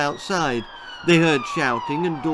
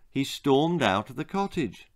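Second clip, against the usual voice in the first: ordinary delivery, nothing marked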